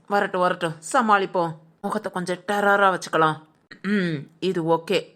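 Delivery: low-pitched aside, bracing herself; low self-talk. Acted. Housemaid to herself before a confrontation, low self-talk. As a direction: talk yourself into a tough front, pitched well down.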